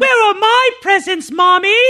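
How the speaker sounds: put-on high loud child's whine; whiny kid voice. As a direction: plead like a small spoiled boy. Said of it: "sorry about the voices".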